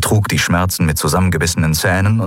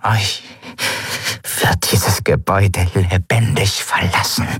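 strained threat. A narrator's reading. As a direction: force each word out with effort. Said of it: "unendliche Anstrengung zu kosten schien".

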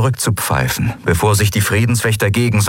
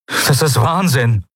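exclaiming; 8 semitones higher than usual. Acted. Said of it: "rief er".

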